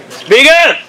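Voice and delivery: calls out loud to the crowd. Real, not acted. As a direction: project it, telling them to speak up.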